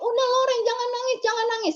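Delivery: urging the grieving mother to stop crying; consoling mourners. Retold or imitated. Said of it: "karena orang bilangin".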